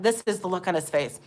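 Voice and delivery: usual speaking voice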